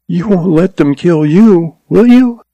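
high, rising, a hint of worry; anxious plea. A narrator's reading. Girl asks the gunman not to die, mildly fearful. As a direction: beg him not to get killed.

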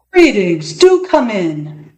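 slow, theatrical host's welcome; grand magician. A narrator's reading. Magician greeting a boy at his door, grand and slow.

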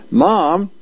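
mild mock yell for mom. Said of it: "somebody yells from off, off the edge of, off screen".

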